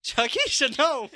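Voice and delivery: high, loud comic shout; mock alarmed cry. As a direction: imitate a bystander's panicked yell. Quoting a viral fight video cry.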